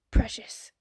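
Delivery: hushed adoring whisper. Real, not acted. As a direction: breathe it out adoringly. Fangirling whisper.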